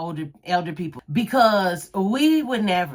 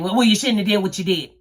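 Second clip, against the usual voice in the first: quick, louder mother's scolding; mama's scolding; 3 semitones higher, 6 dB louder, faster than usual. Retold or imitated. Mama brushing off the kids' complaint, quick and loud. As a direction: rattle it off like a stern mama. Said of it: "Her thing".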